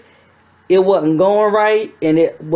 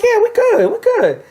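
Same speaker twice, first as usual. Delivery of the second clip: much higher, breezy nurse voice, lightly voiced; nurse's easy reassurance. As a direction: toss it off casually as the nurse. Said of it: "She's like".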